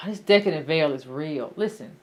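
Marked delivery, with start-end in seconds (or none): none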